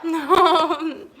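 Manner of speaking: loud, high drawn-out protest with a laugh; laughing dismay. Real, not acted. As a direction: wail it while laughing.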